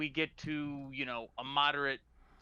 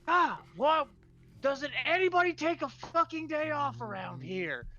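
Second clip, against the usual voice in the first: much higher, louder put-on voice, griping; grumpy wake-up; 14 semitones higher, 5 dB louder than usual. Vorse woken by roommates' racket, high indignant grumble. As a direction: gripe loudly at the noise from bed.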